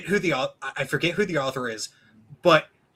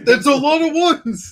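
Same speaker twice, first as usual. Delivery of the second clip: mock excited echo; 11 semitones higher, 8 dB louder than usual. Echoes the mock line.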